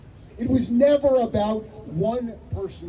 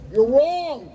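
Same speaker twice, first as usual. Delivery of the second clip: higher, firm group rejection; the students rejecting the rabbi's miracle, higher and firm; crowd rejecting; 6 semitones higher, 4 dB louder than usual. Retold or imitated. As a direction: reject it flatly, all together. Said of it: "They all say".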